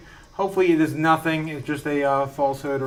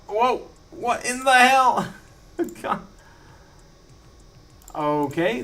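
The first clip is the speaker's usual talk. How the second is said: usual speaking voice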